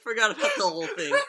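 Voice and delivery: high-pitched, laughter-filled telling; helpless laughing. Real, not acted. Telling how his brother fell back asleep, cracking up. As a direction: barely get the punchline out for laughing.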